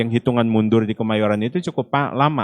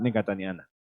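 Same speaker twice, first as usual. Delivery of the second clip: ordinary delivery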